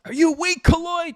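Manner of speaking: top-of-lungs yell. Retold or imitated. Platoon sergeant to a Marine dropping out of the run, yelling. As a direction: drill sergeant's loud, high-pitched holler. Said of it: "he just yells at the top of his lungs".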